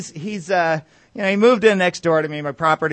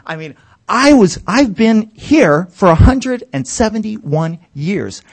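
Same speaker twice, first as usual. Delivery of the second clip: louder, higher gloat; mock bragging shout; 4 semitones higher, 7 dB louder, slower than usual. Immortal corporate neighbor bragging about age, loud higher gloat. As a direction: brag it, loud and slow. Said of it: "shouting match".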